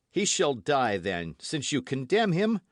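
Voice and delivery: resolute, steady promise; firm. A narrator's reading. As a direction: say it firmly, as a pledge of devotion. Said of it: "in so firm a tone".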